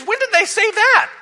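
high-pitched disbelieving question; incredulous. Real, not acted. Preacher challenges the people's claim to Moses, incredulous.

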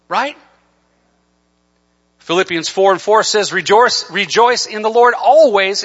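loud, high, driving sermon climax; full-force preaching. Acted. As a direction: drive the exhortation home to the congregation.

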